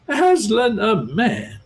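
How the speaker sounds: slow, high indignant beaver; stern. A narrator's reading. Beaver rebuking Lucy's question, slow and stern. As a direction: scold the very idea. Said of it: "said Mister Beaver sternly".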